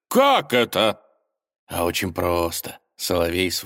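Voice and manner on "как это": shocked. A narrator's reading. Impostor shocked.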